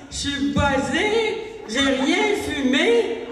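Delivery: dazed slow drawl. Retold or imitated. Dazed after the knock.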